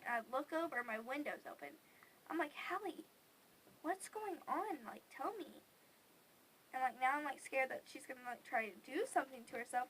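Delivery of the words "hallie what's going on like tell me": higher, drawn-out plea to a sister; pleading questioning. Retold or imitated. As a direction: plead softly, pitched up. Questioning sister at night, retold.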